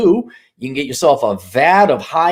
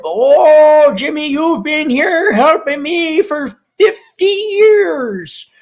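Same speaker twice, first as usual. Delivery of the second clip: put-on much higher, slow, choked-up old dealer; teary old man; 13 semitones higher, 4 dB louder, much slower than usual. Old car dealer thanking Jimmy for years of help, teary. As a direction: get misty thanking your loyal helper.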